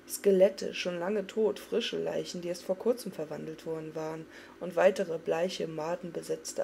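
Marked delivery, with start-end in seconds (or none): none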